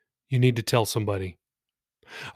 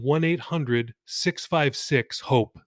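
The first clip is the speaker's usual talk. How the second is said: slow, clear number; 4 dB quieter, slower than usual. Shown as a demonstration. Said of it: "That's one eight hundred six five six hope".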